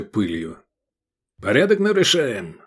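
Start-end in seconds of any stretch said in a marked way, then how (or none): 1.4-2.6 high-pitched officious bark; barked reprimand. A narrator's reading. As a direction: bark it like a guard scolding offenders. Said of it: "рявкнул Батав". (рявкнуть повыше, с укором)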